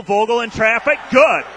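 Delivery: rising excitement as the shot drops; excited call. Real, not acted. Announcer calling Vogel's basket through traffic, excited burst.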